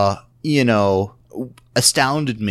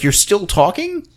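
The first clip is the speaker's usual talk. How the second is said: impatient jab; 10 semitones higher than usual. Retold or imitated. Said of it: "he was hard on these people".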